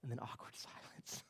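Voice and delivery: breathy whisper with a laugh; whispered aside. Acted. Aside on awkward pause.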